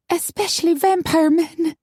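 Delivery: high-pitched grumble; grumbled. A narrator's reading. Tipsy woman adding to her friend's toast, high grumble. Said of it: "Maggie grumbled".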